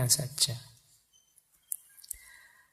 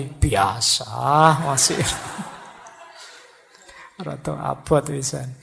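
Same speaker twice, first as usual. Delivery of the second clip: strained nonchalance. Acted. Forgetting the key a third time, comically strained nonchalance. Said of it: "intonasinya yang agak berbeda".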